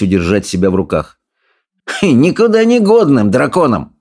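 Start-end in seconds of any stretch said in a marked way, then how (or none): 2.0-4.0 high, dismissive sneer; mocking bully, pitched up; scornful snort. A narrator's reading. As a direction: snort it with contempt. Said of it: "фыркнул Сопляк".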